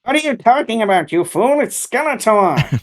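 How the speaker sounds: high, campy cartoon villain voice; Skeletor. Co-host demonstrates cartoon villain's voice, high camp outburst. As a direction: scold in a high villain voice, dragging out the name. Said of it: "That was his voice?".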